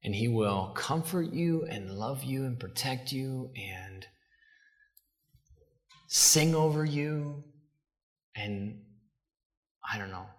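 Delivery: mock lovey-dovey. Preacher mocking the soft fluffy Jesus, syrupy and hushed. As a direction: coo it like a sappy greeting card. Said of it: "fluffy, wonderful, lovey things".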